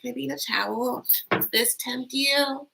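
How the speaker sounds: coy tease. Retold or imitated. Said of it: "She's like".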